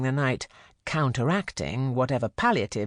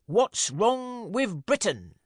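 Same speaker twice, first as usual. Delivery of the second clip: high, slow ceremonial announcement; pompous herald's cry; 8 semitones higher, slower than usual. A narrator's reading. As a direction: call the House to order like a herald.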